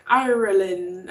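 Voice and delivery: slow, drawn-out Irish-accented "Ireland". Shown as a demonstration. Greeting a viewer from Ireland, slow Irish accent. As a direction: say "Ireland" slowly the way an Irish person would. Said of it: "I have to say it with an accent".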